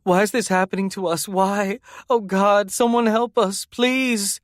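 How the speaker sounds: high, pleading anguished cry; tortured high plea, rising as it goes; crying out in torment. A narrator's reading. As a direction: beg for help through panic. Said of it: "She writhed and cried out".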